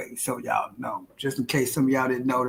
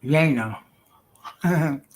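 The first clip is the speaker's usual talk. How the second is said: ordinary talk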